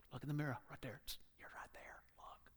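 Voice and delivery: hushed, breathless whisper to a hidden listener; soft whisper. Acted. God whispers to seeker at the mirror, hushed whisper. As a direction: whisper it like a secret in the ear.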